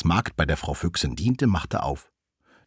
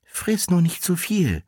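high-pitched crafty fox; high, teasing fox voice; sly fox; 10 semitones higher than usual. A narrator's reading. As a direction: pitch it up as a wily fox giving a warning. Sly fox warns the wolf.

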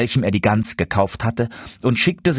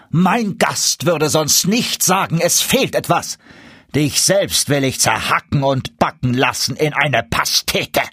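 furious duke; 5 semitones higher than usual. A narrator's reading. Duke kicks away the dwarf, raging. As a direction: threaten him with every word.

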